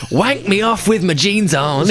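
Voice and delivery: high, drawn-out mock rock wail. Spoofing uncensored Led Zeppelin lyrics, high rock-singer wail. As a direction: belt it like a rock frontman. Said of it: "like [name] going".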